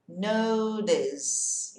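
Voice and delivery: "Notice" with a light D in the middle; flapped T. Shown as a demonstration. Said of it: "esse T vai virar um som de D bem leve".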